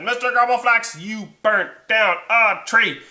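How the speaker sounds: loud, high indignant call; acted accusation. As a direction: charge him word by word. Liam accuses the dragon.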